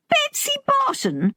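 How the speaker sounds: very high, disbelieving echo; high-pitched amazement at who it is; incredulous question. A narrator's reading. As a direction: query the name in astonishment. Daughter still cannot believe the name.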